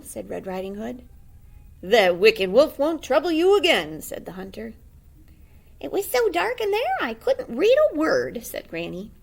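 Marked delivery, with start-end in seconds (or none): 1.8-4.0 loud, put-on hero voice; brave hunter. The hunter speaks. 5.8-8.4 high, loud, put-on granny; old granny voice. Granny speaks.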